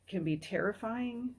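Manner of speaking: usual speaking voice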